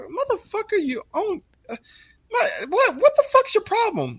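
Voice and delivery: very high squeal with laughter breaking in; falsetto mock rant. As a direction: squeak it through your own laughs. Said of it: "we're like".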